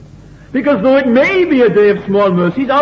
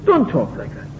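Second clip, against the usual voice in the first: "Don't talk like that" repeated more softly, dropping away; softer repeat; 3 semitones lower, 6 dB quieter than usual. Retold or imitated. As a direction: let the repeated rebuke fall quiet. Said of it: "And Jesus says".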